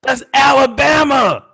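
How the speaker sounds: high, long-drawn holler; mock outrage. Real, not acted. Co-host outing a prank caller, mock-outraged holler. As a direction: holler it out, stretching the name.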